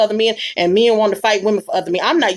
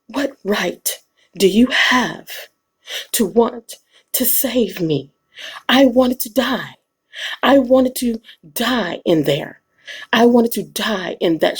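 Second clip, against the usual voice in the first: slow, theatrical anguish as a woman who wanted to die; hammed-up tragic heroine, drawn out and slow; dramatic thespian reading; slower than usual. Woman scolding the man who saved her, in slow overwrought drama. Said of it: "That was my thespian".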